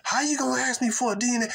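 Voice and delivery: high, fast mock outrage at being asked; mock indignant woman. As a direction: play an offended woman denying everything. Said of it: "believed the pimping of the woman".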